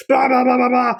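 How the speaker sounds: loud, high blah-blah banging; cartoon pounding noise; mock banging noise. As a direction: bang away with your voice. Imitates banging on cockpit.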